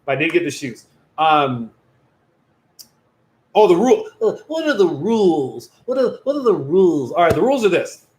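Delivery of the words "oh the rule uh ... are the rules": put-on voice of a pestering viewer; hammed-up imitation of a viewer asking; silly mocking voice. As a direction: mimic the questioner with swooping pitch. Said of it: "Don't talk like that".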